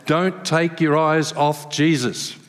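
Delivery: slow, heavy preacher's warning; mock old-school sermon line, drawn out; slow preachy warning. Mimicking childhood preachers, a slow stern warning. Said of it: "the take-home message was always".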